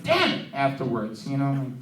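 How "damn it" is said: high-pitched quick exclamation; frustrated blurt. Acted. Coworker after each outburst, high frustrated blurt. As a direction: squeak it out in mock frustration. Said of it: "every time he says, like".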